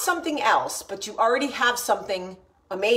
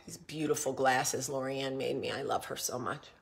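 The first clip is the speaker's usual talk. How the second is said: choked up; 10 semitones lower, 10 dB quieter than usual. Real, not acted. Emotional, voice dropping.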